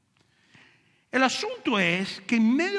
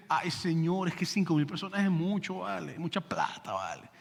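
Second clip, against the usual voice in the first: put-on Venezuelan accent; 4 semitones lower, 6 dB quieter than usual. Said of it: "Felipe era venezolano, como hablaba así".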